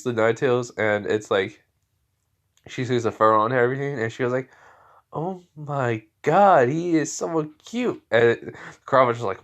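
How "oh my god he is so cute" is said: high, drawn-out squeal of delight; melting with cuteness, pitched up; gushing. Acted. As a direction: gush slowly over something adorable. Girl gushes over cute creature.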